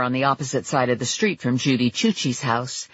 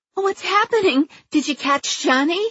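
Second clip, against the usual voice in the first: very high, fluttery woman's voice; a flustered homeowner calling from the porch; anxious neighbor Judy. Acted. Narrator voicing neighbor Judy.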